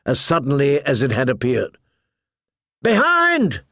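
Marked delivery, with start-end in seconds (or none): 2.8-3.6 high warning yell. A narrator's reading. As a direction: warn her sharply. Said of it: "Hot Pie yelled".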